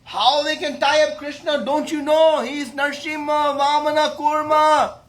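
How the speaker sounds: high, loud boastful protest; bold indignation. Acted. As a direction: proclaim it hotly, full of bravado. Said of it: "He became bold".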